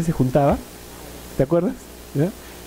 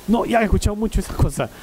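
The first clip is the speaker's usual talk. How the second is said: fed-up complaint; 5 semitones higher than usual. Retold or imitated. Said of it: "lo veo fastidiado".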